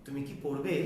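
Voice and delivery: brief low কি, rising on পড়বে; short কি. Shown as a demonstration. Teacher asking whether you will study, short কি. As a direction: pass quickly over কি, lift পড়বে as a yes-no question. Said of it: "ছোট করে দ্রুত উচ্চারণ".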